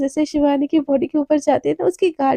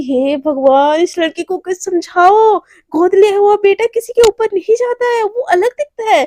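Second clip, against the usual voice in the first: raised, higher-pitched exasperated complaint; exasperated protest; 5 semitones higher, 4 dB louder than usual. Acted. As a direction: appeal to heaven, then argue it loudly. Exasperated with partner in acted quarrel. (झुंझलाहट में ऊँची आवाज़ की शिकायत)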